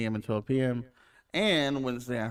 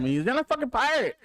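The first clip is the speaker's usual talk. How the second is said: high-pitched mock yell; imitated yell; 11 semitones higher, 3 dB louder than usual. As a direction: yell it like an exasperated movie character. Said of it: "he just yells at him".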